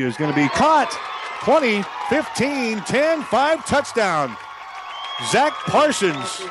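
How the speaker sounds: rising to a big excited shout; excited touchdown call. Real, not acted. Touchdown call on long pass.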